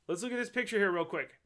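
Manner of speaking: ordinary delivery, nothing marked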